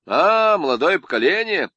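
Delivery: loud jovial greeting; hearty loud greeting. Acted. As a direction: greet them boldly. Dzerzhinsky greets loudly.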